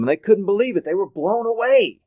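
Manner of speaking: high, loud storytelling climax; amazed emphasis. Real, not acted. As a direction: build to a loud, high-pitched burst of amazement.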